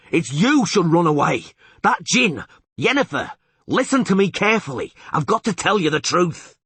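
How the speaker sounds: high, loud desperate warning; urgent shout. Acted. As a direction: shout the truth at her.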